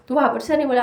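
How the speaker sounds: ordinary talk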